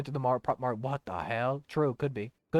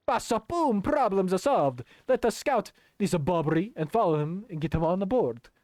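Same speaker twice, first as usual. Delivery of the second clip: put-on exultant, higher Italian accent; 6 semitones higher than usual. Flavio declares the problem solved, excited Italian voice. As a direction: proclaim it grandly in an Italian accent. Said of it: "the kind of Italian voice".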